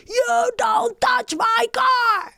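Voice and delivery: high, drawn-out scream of warning; re-enacted scream. Driver screaming at the man who knocked, high drawn-out yell. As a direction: scream it slowly, far up in pitch. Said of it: "I can also scream".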